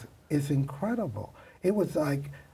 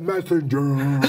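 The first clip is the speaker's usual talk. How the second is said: a lightly put-on messenger voice, louder; deeper voice; 5 dB louder, slower than usual. Makeup artist copies the messenger to get buzzed in, lightly put-on voice. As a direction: announce yourself as the messenger, a bit deeper in manner. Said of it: "I did it in a deeper voice".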